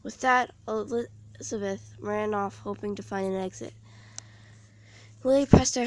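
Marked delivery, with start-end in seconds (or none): none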